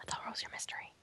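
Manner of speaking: hushed whispered cue; whispering. Real, not acted. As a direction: whisper it under the others. Prompting a player's turn quietly.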